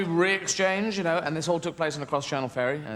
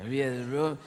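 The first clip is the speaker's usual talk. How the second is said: put-on imagined Roman accent. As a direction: announce the invasion in an understated silly voice. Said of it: "for all they knew, could have said".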